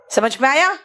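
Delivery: ordinary delivery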